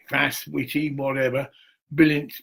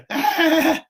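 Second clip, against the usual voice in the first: imitated laugh track; 11 semitones higher, 4 dB louder than usual. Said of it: "And they go".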